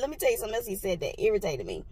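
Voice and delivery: ordinary talk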